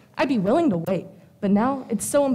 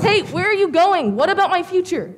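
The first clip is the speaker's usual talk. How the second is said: higher, louder cry of protest; urgent protest; 6 semitones higher, 4 dB louder than usual. Acted. Client calling after the departing fortune teller, urgent protest. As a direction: call after someone who is leaving.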